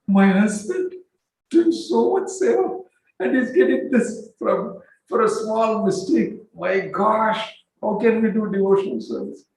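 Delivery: high, pleading lament; distressed wife. Retold or imitated. Wife lamenting the curse on her husband's sons. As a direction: cry out in dismay.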